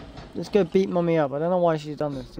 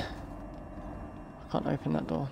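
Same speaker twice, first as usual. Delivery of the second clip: whisper; 3 semitones lower, 10 dB quieter, much slower than usual. Real, not acted. Whispering while sneaking in horror game.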